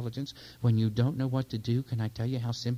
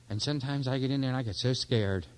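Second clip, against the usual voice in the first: usual speaking voice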